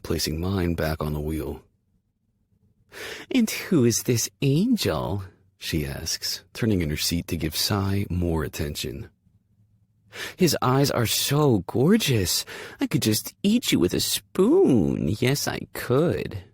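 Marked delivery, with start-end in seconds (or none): 3.3-5.2 put-on dog baby talk. Cooing to the dog. 10.4-16.4 high, slow cooing to a dog. Acted. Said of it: "she coos".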